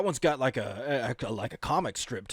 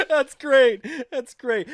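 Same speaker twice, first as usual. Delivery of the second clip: laughing; 12 semitones higher, 7 dB louder, much slower than usual. Real, not acted.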